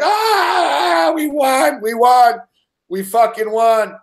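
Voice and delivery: high, loud victory yell; ecstatic yelling. Retold or imitated. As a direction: scream that you just won big. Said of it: "yelling and shit".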